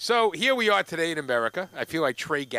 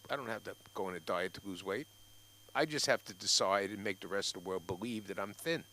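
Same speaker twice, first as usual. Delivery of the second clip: lower, soft self-talk at the mirror; musing to mirror; 6 semitones lower, 5 dB quieter than usual. Retold or imitated. To his mirror, quiet private musing. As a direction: muse quietly to yourself.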